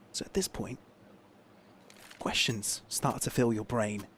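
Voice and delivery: dropped to a soft murmur; hushed. Acted. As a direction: lean in and go quiet. Dramatic hushed aside.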